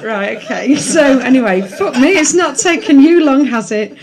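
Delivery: book reading voice; reading aloud. Acted. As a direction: read the passage with a storyteller's lilt. Reads his book aloud.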